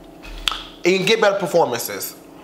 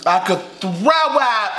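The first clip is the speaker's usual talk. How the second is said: higher, very slow, drawn-out moan about being stuffed; dramatic groan; 6 semitones higher, much slower than usual. Acted. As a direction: ham up how sick you feel. Dramatic repeat after laugh.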